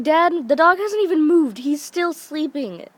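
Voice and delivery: high, insistent pleading; exasperated protest. Retold or imitated. Daughter argues with half-asleep dad about dog, exasperated.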